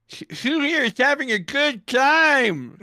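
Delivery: high, bright question to crowd; high crowd call. Acted. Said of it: "he's like".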